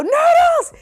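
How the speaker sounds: high piercing scream. Retold or imitated. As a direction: shriek the dog's name in fright. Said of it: "I scream".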